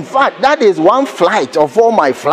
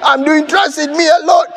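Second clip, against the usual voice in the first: retold crying wail; 6 semitones higher than usual. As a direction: wail it like a self-pitying traveller. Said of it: "I was crying and I was saying".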